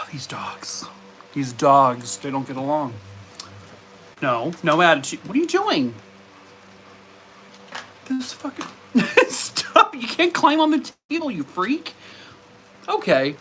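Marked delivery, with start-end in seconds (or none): 0.0-0.9 exasperated sigh. Real, not acted. Sighs at fighting dogs. 5.3-11.9 high-pitched scolding of the dog; exasperated pet-scolding voice. Real, not acted. Scolding dog climbing table.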